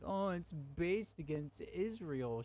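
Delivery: high, slow, whining mockery of a crybaby; mocking whiny voice. Streamer mocks a chat user as a crying whiner, whiny mockery. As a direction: sneer it out like a mock sob. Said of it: "you're over here crying, being like".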